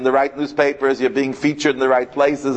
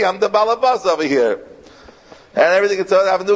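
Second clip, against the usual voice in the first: excited frantic rant; 6 semitones higher than usual. Retold or imitated. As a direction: rattle it off, overwhelmed and flustered. Moshe overwhelmed by the new job.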